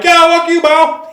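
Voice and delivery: high, loud jeering taunt; mock taunting. Pretending to mock a crying stranger, high loud jeer. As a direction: jeer at someone crying, hammed up.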